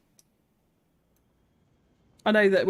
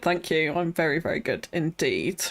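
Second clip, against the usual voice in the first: ordinary talk, nothing marked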